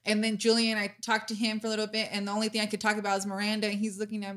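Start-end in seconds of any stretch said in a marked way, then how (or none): none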